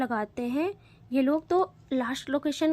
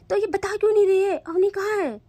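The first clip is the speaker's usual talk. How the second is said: much higher, louder, fast angry shout; angry yell; 8 semitones higher, 6 dB louder than usual. Acted. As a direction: shout her down impatiently. Payal yells at scared girl.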